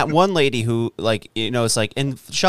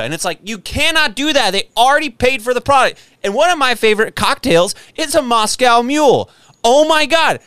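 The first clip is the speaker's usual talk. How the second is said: real exasperation, pitched way up; worked-up screaming; 5 semitones higher than usual. Real, not acted. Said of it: "I'm gonna stop screaming".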